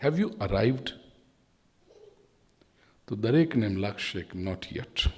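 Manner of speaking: ordinary delivery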